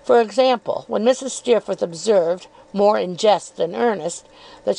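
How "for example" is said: plain reading voice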